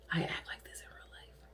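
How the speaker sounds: whispered aside; soft whispered confession. Real, not acted. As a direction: breathe it out in a secret whisper. Repeats line as aside.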